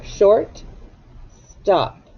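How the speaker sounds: "Short" lifted, a long pause, then "stop" falling; divided compound word. Shown as a demonstration. Teacher showing where the dot divides the word, pausing between parts. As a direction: say "Short ... stop" as two separate words. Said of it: "put our dot right there".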